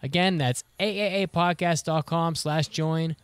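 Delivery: upbeat commercial read. Acted. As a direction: pitch it like a radio spot. Podcast ad read.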